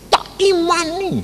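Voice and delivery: high, soft mock declaration; imitated faith claim. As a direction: pitch it way up, mimicking insistent faith. Quotes sister's stubborn faith. (menirukan klaim iman bernada tinggi)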